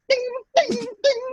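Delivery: high sung ding ding; hammer ding. Retold or imitated. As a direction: mimic a blacksmith's hammer ringing. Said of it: "I don't know how to make the sound".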